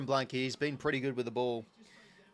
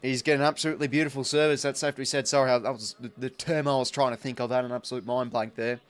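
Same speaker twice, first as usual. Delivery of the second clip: excited chatter; 9 dB louder than usual. Real, not acted. Still excited after the goal.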